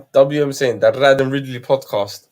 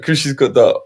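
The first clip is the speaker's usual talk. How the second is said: ordinary talk, nothing marked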